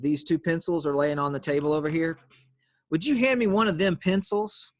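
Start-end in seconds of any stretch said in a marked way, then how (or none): 2.9-4.7 teacher slips into a higher country accent. Shown as a demonstration. Teacher demos a country accent to the class, higher pitched. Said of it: "I have to say it in a country accent".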